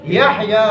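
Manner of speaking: "یحییٰ" with forced, full h; careful teacher's "یحییٰ"; correct pronunciation. Shown as a demonstration. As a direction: say "Yah-yaa" for "یحییٰ". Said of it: "ابھی آپ جتنا بھی زور لگاؤ".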